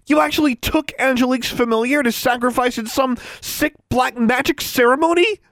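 high-pitched, accusing disbelief; outraged exclamation. A narrator's reading. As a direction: fling the accusation with voice held high. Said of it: "she exclaimed".

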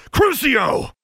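high shouted incantation; furious spell. A narrator's reading. Rescuer turns the curse on the bully, furious shout.

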